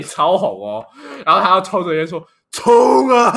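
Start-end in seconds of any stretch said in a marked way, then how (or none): 2.5-3.3 higher, louder cheer of "冲啊"; mock battle cry. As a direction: imitate a drunk friend egging him on. Drunk friend cheering him on.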